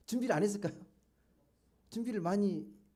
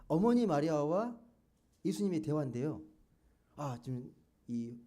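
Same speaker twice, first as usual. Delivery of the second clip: ordinary delivery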